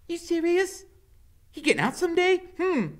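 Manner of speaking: high, loud chatter; high giddy woman. A narrator's reading. Loretta guessing to Iggy, high and loud. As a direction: gossip eagerly.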